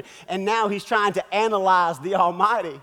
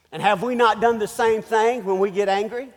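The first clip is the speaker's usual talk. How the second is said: ordinary delivery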